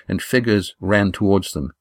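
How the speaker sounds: usual speaking voice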